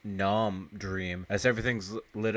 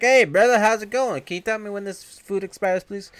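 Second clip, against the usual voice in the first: much higher, louder fake-friendly voice; butter up the manager in a squeaky high voice; hammed-up friendly bum; 11 semitones higher, 8 dB louder than usual. Bert distracting the store manager, squeaky high and loud.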